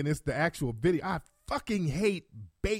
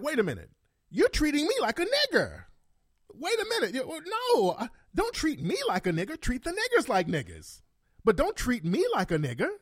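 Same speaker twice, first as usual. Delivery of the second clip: high-pitched mock indignation; imitating the woman; 7 semitones higher than usual. Woman objecting to how officers treat her, mock indignant protest. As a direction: protest in a put-on higher voice, swooping up. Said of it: "So this woman".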